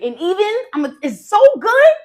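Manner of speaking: can't contain how good it is; excited burst. Real, not acted. Hyping the recipe to viewers.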